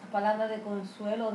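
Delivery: ordinary delivery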